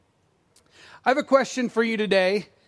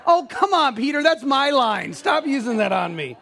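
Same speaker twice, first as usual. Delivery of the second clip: playful outburst that settles by the end; laughing protest; 5 semitones higher than usual. Retold or imitated. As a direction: laugh while protesting to a friend. Pastor retells protesting to friend Peter.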